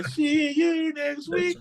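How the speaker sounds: very slow, high sing-song sign-off; mock sitcom sign-off, high and slow; catchphrase impression. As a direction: draw it out high and slow like a TV closer. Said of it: "And then you hear".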